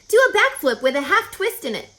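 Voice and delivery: loud, quick, high call; crying out. Acted. Charlotte calling a trick to Wilbur, crying out. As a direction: toss the order out eagerly. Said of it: "cried Charlotte".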